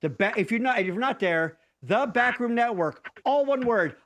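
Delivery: normal talking voice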